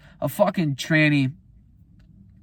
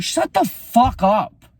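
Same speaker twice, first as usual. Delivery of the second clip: slow, louder, higher snap back; angry retort; 8 semitones higher, 6 dB louder, slower than usual. Retold or imitated. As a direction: spit it out slowly and angrily. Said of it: "I'm like".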